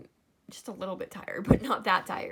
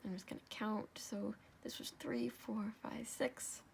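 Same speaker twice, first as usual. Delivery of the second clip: soft murmured counting; counting under breath; 8 dB quieter than usual. Real, not acted. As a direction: count quietly to yourself.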